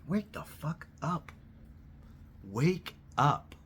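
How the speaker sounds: slow and pressing, then punch it; frustrated plea. Real, not acted.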